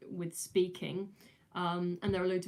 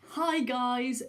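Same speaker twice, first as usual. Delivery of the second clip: a touch higher, louder YouTuber hello; hint of a peppy vlogger; YouTuber greeting; 5 semitones higher, 5 dB louder than usual. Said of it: "that's not really me".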